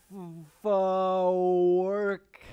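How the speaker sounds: very loud, slow, hammed-up; loud drawn-out yell. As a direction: belt it out with a stretch. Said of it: "I said".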